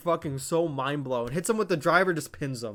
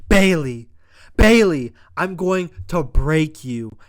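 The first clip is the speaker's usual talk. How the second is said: much louder, slow menacing callout; threatening call-out; 10 dB louder, slower than usual. Retold or imitated. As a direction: call her out to break her. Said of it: "Dakota Kai says".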